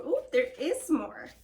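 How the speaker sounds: ordinary delivery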